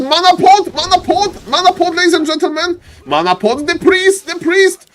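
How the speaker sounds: high, urgent repeated calls; excited play-by-play. Real, not acted. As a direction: call out the sudden play in alarm. Live esports play-by-play.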